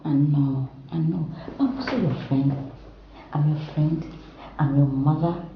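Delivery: ordinary delivery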